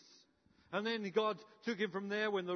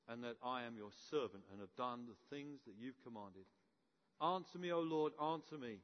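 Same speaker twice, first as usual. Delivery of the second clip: reverent prayer; 7 semitones lower, 7 dB quieter than usual. Retold or imitated. Elijah on his knees, praying quietly and earnestly to God. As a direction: pray humbly, almost under the breath. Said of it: "he prays this".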